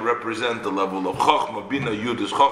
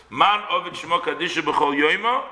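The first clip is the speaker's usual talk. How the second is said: ordinary delivery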